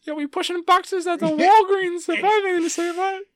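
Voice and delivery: loud, very high squeaky mouse; a villainous squeaking cartoon mouse; Mickey Mouse voice. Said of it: "Mickey Mouse Voice".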